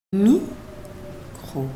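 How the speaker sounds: "Micro" split as Mi... cro; slow "Micro" with a long pause after Mi; syllable by syllable. Shown as a demonstration. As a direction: sound out "Micro" in two separate syllables. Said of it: "deux syllabes".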